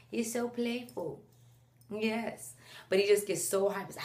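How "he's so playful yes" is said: put-on soft, slow sing-song baby-directed voice; cooing to baby. Mom cooing to her son in her arms, soft and slow. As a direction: coo it to the baby in your arms.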